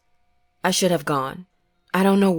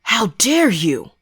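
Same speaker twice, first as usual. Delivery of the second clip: slow, outraged ghost; furious; 4 semitones higher, slower than usual. A narrator's reading. Father's ghost outraged at the family, slow and furious. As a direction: spit it out, betrayed.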